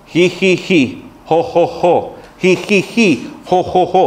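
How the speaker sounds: imitated holy laughter in even, separate syllables; forced fake laugh. Pastor shown how to fake holy laughter, mock mechanical laugh. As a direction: mechanical ji-ji-ji, jo-jo-jo, badly faked. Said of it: "hágale así".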